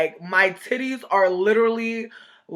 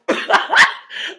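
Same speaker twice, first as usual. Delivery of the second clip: high airy laugh; 3 dB louder than usual. Real, not acted. Laughing at own story.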